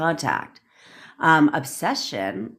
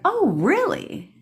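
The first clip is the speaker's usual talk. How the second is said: mock surprise; 13 semitones higher, slower than usual. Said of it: "she's like".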